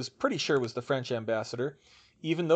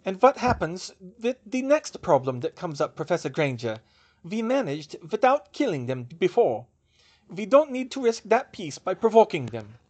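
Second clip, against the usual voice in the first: put-on raised, firm German-accented argument; thick German accent; 7 semitones higher, 6 dB louder than usual. A narrator's reading. German witch warning against provoking Dementors, thick German accent. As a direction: press the objection in a German accent. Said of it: "she said in a thick accent".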